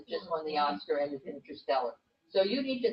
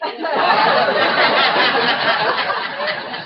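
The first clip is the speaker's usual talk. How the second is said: big open laugh, rising; loud hearty laugh; 5 semitones higher, 13 dB louder than usual. Real, not acted. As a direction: burst out laughing. Audience-style big laugh.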